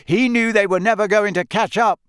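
high, loud shout over noise; shouting back. A narrator's reading. Harry answering Ron over all the noise, shouting. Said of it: "Harry shouted back over all the noise".

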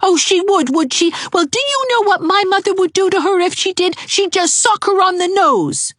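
put-on a boy sneering back; taunting boy.

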